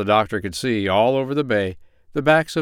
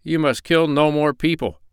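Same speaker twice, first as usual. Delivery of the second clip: higher-pitched, lightly acted stern doctor; 6 semitones higher, 3 dB louder than usual. A narrator's reading. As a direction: lecture a pirate firmly in a raised pitch. Doctor lays down terms.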